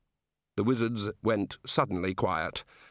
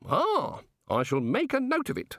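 innocent, measured wondering; curious, genial god; 12 semitones higher than usual. Acted. God asking wizards how it all works, innocently curious.

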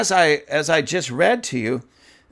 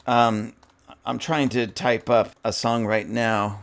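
ordinary talk, nothing marked

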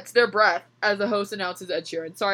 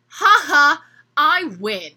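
loud, higher gloating laugh; gloating text; 7 semitones higher, 10 dB louder, much slower than usual. Acted. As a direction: crow over the win. Gloating text read aloud.

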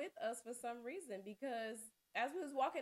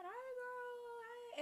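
high sing-song greeting; 5 dB quieter, much slower than usual. Retold or imitated. Sing-song greeting at her door.

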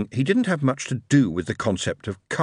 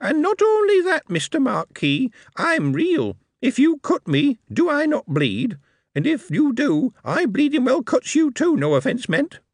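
high, ringing, sarcastic goblin; ringing sarcasm. Acted. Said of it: "in ringing sarcasm".

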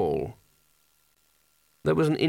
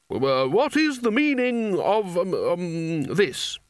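high, slow faltering bluster; weak bluster; 5 semitones higher, much slower than usual. A narrator's reading. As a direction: lose your nerve mid-sentence. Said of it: "It was pretty weak".

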